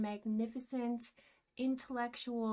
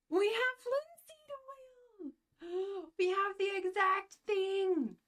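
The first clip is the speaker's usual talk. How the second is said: excited gasp; 8 semitones higher than usual. Real, not acted. Painter finding the exact oil, gasping with excitement. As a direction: gasp and shout with delight.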